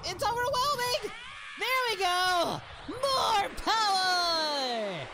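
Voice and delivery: high shout, slowly drawn out; straining battle cry. Acted. Goku powering up in a beam struggle, straining shout. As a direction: push harder with every word, stretching the last.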